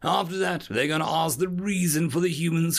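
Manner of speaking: briefing observer. Acted. Observer explaining the hearing to his assistant, emphatic.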